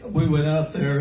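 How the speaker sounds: usual speaking voice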